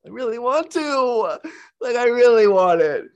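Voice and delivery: high, slow, yearning plea; longing inner voice. Retold or imitated. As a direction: voice the part of you that craves it. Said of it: "part of my sexual self that's like".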